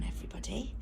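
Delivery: plain reading voice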